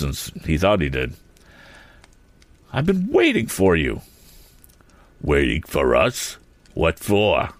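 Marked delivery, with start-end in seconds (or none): 2.7-4.0 high-pitched, indignant; cross young hero, voice raised in pitch; angry confrontation. A narrator's reading. Tom angrily confronts Andy. 5.2-7.5 lightly voiced slow questioner; a hint of a dim sidekick; puzzled bully's crony. A narrator's reading. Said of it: "asked Sam Snedeker".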